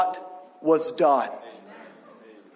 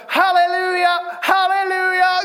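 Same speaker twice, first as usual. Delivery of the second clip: screamed praise; 10 semitones higher, 5 dB louder than usual. Retold or imitated. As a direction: imitate a little girl joining the shouting. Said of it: "We're all screaming and praising".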